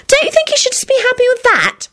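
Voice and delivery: very high, insistent question; demanding. A narrator's reading. Solange demanding Quentin's opinion, high and insistent. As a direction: press for agreement in a high pitch. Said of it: "she demanded".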